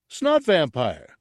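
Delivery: high, slow character shout; emphatic high-voiced exclamation; shouted answer. A narrator's reading. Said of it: "Gartog shouted".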